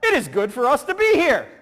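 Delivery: loud, high exclamation as an eager disciple; acted excited outburst. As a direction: gush it loudly, hammed-up. Voicing excitable apostle Peter.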